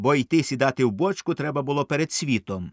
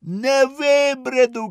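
high, slow, loud despairing yell; desperate shout; 12 semitones higher, 5 dB louder, much slower than usual. A narrator's reading. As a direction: wail that you can't wade out. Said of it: "кричить". (розпачливий крик)

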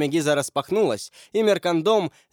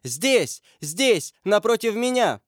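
high-pitched urgent call; 7 semitones higher than usual. A narrator's reading. Lady directs the men from the house, calling out. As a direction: call out high to point someone out. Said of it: "крича вышедшим из дома людям".

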